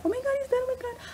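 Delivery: squeaky mock-hysterical wail; mock panic. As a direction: imitate fans shrieking in mock horror. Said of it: "people were, like, having a heart attack".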